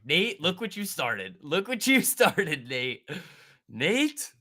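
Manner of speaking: slow, loud, high, laughing mock blame; laughing scold. Real, not acted. Streamer teasing viewer Nate, laughing scold. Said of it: "you're gonna get me in trouble".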